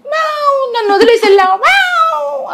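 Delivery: very high mewing cat voice, sulky and put out; angry cat voice. Retold or imitated. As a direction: voice the cat scolding her owner for leaving. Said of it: "అని కోపంగా". (కోపంగా ఉన్న పిల్లి గొంతు, చాలా ఎత్తుగా)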